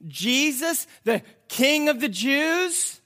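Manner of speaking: high, loud public appeal; Pilate addressing the crowd. Acted. Pilate offers the crowd a prisoner, loud appeal. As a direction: pitch the question out to a crowd, stretching the end. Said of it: "he says to the people".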